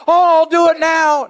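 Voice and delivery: put-on high, loud whiny kid begging; a child's loud high plea to escape punishment; pleading kid. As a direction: beg like a child caught out. Kid begging parent too late.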